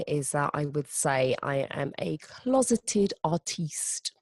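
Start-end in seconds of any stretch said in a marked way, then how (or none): 2.2-4.1 drawn-out, slightly higher mock-French accent. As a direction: draw it out slowly with a French accent. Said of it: "say this in a French accent".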